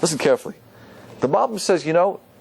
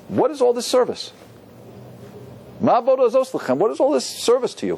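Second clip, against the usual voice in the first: lightly voiced evil son, pitched higher; a higher-pitched challenge, lightly acted; evil son's question; 7 semitones higher than usual. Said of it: "The evil son's question is".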